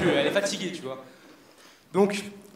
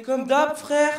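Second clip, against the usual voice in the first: aigu et un peu plus fort; lance-le d'un ton complice et haut perché; barber voice; 9 semitones higher, 4 dB louder, much slower than usual. Retold or imitated. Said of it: "Lui, il fait". (voix haut perchée de barbier complice)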